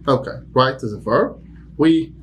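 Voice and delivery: ordinary delivery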